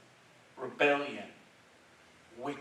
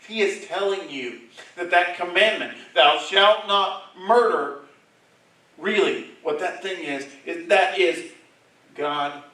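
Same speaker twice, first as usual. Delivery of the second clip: loud, high, insistent pulpit voice; preaching at full force; 6 semitones higher, 15 dB louder than usual. Acted. Preacher explaining murder commandment to congregation, full force. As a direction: drive the commandment home.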